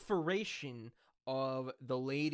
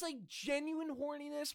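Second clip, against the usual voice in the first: voice cracking up an octave; 9 semitones higher, 5 dB quieter than usual. Real, not acted. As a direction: voice breaks high on genuine. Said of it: "my voice cracked there".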